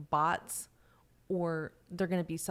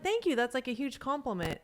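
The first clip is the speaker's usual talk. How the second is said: higher, sarcastic thank-you; sarcastic gratitude, pitched up; mock flattered; 7 semitones higher than usual. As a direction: play it as a fake-delighted thanks. Replying to a roast.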